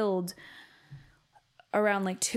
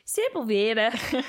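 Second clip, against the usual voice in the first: mock Irish accent; 3 semitones higher, 5 dB louder than usual. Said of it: "She said".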